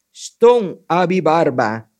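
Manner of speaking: loud, drawn-out magic words; slow incantation. A narrator's reading. Anansi naming the bearded magic stone, slow incantation. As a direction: intone each part slowly and loudly.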